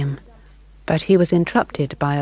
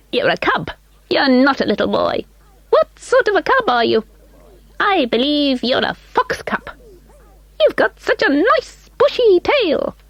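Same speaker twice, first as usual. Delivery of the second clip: very high, piping call; high little elf calling; 11 semitones higher than usual. A narrator's reading. Mister Munty voiced.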